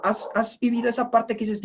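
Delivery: normal talking voice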